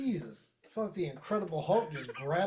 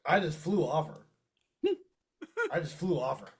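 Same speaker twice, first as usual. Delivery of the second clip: usual speaking voice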